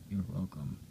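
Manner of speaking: ordinary delivery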